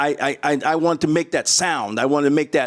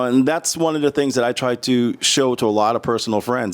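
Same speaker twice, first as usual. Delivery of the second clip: ordinary delivery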